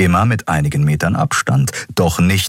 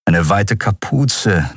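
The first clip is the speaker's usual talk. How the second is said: ordinary delivery, nothing marked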